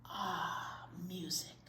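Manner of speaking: soft awed whisper. Acted. Narrator marveling as the music begins, in a soft whisper. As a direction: breathe it out in wonder.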